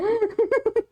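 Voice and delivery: shrill burst of giggles; high squeal laugh. Real, not acted. Streamer squealing with laughter after mispronouncing the show name. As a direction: laugh at your own dumb joke.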